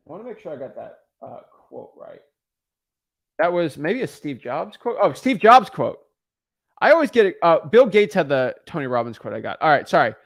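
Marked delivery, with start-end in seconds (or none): 0.1-2.2 muttered aside. Real, not acted. 5.0-7.4 exasperated self-correction. Real, not acted.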